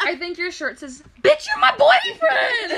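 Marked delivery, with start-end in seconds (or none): 1.2-2.7 high, loud mimicked yell, laughing; mock outburst. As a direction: parrot an outraged holler. Co-host echoes her outburst, joking.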